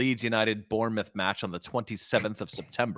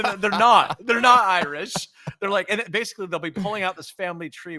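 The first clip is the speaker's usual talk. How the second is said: raised, loud indignant voice; exasperated rant; 8 semitones higher, 5 dB louder than usual. Real, not acted. Ranting about fake Irish Americans, loud and exasperated. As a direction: vent at the nonsense loudly.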